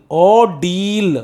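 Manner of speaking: "ordeal" as "aw-deel", one long ee in the ending; correct pronunciation. Shown as a demonstration. Teacher modelling the word for students, "ordeal" pronounced aw-deel. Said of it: "the pronunciation is".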